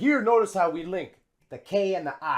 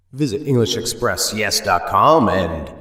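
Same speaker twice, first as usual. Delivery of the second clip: louder, deeper sign-off plug; loud outro; 11 semitones lower, 7 dB louder than usual. Acted. Host urging viewers to subscribe, loud outro. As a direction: call it out like a promo. Channel outro call to action.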